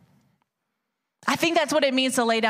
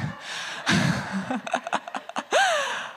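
laugh; 12 semitones higher, 4 dB quieter than usual. Real, not acted. Laughing at her own bit.

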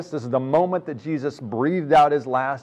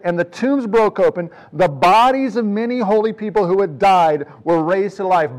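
preaching at full force; 6 semitones higher, 8 dB louder than usual. Acted. Preacher rereading the verse to stun the congregation, fervent full-force preaching. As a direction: proclaim it like a revelation.

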